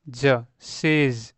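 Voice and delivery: "सीज" said slowly with a clear z sound at the end; pronunciation demo. Shown as a demonstration. Teacher shows how "सीज" ends, slow and clear. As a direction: stretch the final "ज" in "सीज" so students hear it. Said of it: "इसको ज पढ़ते हैं".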